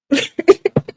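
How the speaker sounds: high laugh breaking through; burst of laughter. Real, not acted. Breaks off the accent demo.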